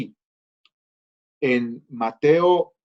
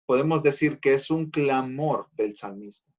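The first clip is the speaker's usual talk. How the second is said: ordinary delivery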